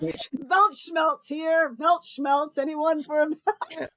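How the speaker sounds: high, slow hawker call; drawn-out selling cry; street vendor. Pretend. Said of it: "I'm seeing an advertising".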